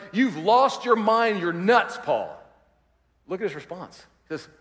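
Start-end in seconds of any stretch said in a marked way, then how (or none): 0.1-2.4 slow, higher, louder mock scolding; mock accusation. Festus accusing Paul, slow mock scolding. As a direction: drag it out as if he's crazy. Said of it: "He's like".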